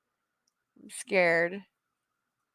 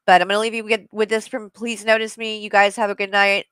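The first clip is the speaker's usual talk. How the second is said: ordinary talk, nothing marked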